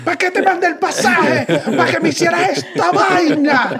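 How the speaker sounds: high, laughing mock complaint over a plane ticket; mock outrage, laughing. Partner mocks the ex who was sent the ticket, laughing. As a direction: squeal it through laughter, slowly.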